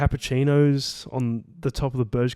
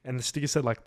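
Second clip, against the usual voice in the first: ordinary delivery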